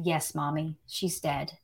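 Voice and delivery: a hint of a devastated son, softly; hushed grief. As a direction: let it fall almost silent, heavy with loss. Son confirms death, hushed.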